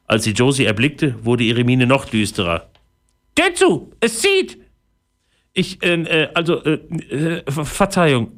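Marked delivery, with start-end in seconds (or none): 3.3-4.6 tiny high voice, snapping an order; a cross fairy barking at the door-opener; snappy fairy. A narrator's reading. Fairy tells Josie to shut the tent, snapping. 5.5-8.3 put-on flustered girl, higher, hesitant; stammering. A narrator's reading. Josie caught peeking at fairy, stammering apology. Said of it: "stammelte Josie".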